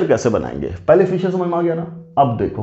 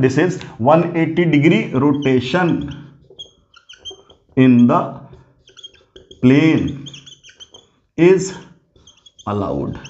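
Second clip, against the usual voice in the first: word by word, with long pauses; slow, emphatic statement; much slower than usual. Real, not acted. Teacher drilling the rotation rule into students, slow and emphatic.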